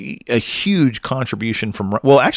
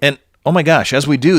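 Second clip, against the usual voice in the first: usual speaking voice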